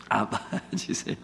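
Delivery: laughing through the punchline; can't help laughing while saying it. Real, not acted. Punchline of a funny story.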